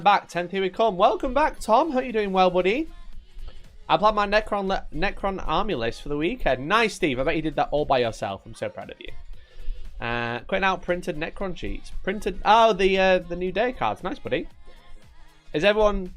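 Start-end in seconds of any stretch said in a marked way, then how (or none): none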